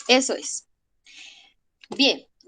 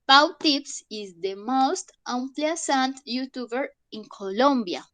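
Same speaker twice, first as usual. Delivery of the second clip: slow dictation; slower than usual. Shown as a demonstration. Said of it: "Veamos este".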